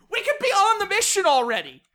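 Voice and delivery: high, loud impatient complaint; worked-up exasperation; exasperated outburst. Acted. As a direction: yell to hurry them along, fed up. Same exasperated outburst continues.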